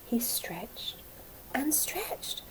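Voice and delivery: whispered, drawn out; whispered stretch. A narrator's reading. Caterpillar waking, stretching.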